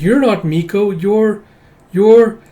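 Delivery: high, halting realisation; dawning recognition. Acted. Child recognising her tiger.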